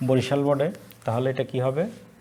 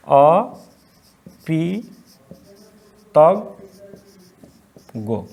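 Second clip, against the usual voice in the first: অকৃতজ্ঞ stretched out extremely slowly; dictating অকৃতজ্ঞ to the class; slow dictation; 2 semitones higher, 5 dB louder, much slower than usual. Shown as a demonstration. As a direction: draw out অকৃতজ্ঞ syllable by syllable. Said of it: "dictating word slowly".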